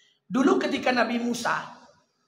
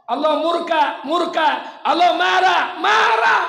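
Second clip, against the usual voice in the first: booming angry shout, much higher and louder; 8 semitones higher, 11 dB louder, slower than usual. Acted. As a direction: thunder out God's fury. Preacher voicing God's wrath. (teriakan marah yang menggelegar)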